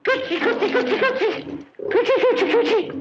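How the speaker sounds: very high, loud cooing; baby talk. Acted. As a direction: coo at the lion like a pet. Cooing to tame the lion.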